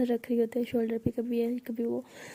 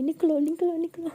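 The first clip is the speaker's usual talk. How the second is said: louder, higher repeated get out; shouting get out; 5 semitones higher, 4 dB louder than usual. Acted. Aalia yelling at her friend to leave, louder and higher. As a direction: yell her out of the room. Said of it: "ऐसे चिल्ला रही होती है".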